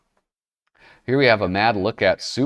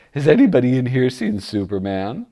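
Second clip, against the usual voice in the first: loud, high-starting comic voice; hammed-up cartoon character asking around; put-on character voice; 3 semitones higher, 5 dB louder than usual. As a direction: call out in a silly put-on voice. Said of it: "I don't know why I gave him that voice".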